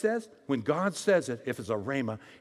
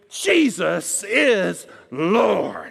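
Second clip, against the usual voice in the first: crying out; 6 dB louder, much slower than usual. Acted. Believer receiving a revelation.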